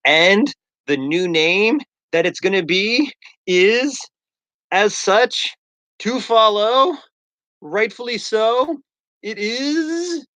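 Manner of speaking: hammed-up game-show host dragging out the reveal; mock name-reveal announcer. Streamer teasing followers with the new name, climbing announcer voice. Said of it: "and I'm like".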